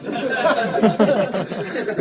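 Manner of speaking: mock whining, laughing; whiny drawl. Mocking ghosts, a slow laughing whine. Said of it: "they're whiny".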